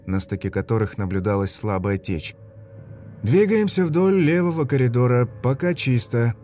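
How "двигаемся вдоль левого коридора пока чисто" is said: soldier's radio report. Acted.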